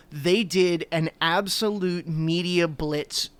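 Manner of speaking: ordinary talk, nothing marked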